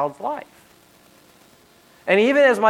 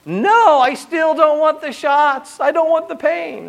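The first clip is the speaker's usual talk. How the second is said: put-on high, louder whiny child voice, slow; little girl refusing; 11 semitones higher, 4 dB louder, slower than usual. Dad imitating daughter still refusing shots, whiny child voice. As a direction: dig in like a stubborn five-year-old. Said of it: "She said".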